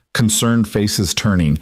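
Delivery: plain reading voice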